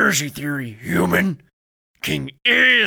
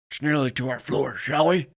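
usual speaking voice

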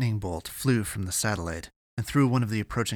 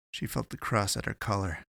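ordinary delivery

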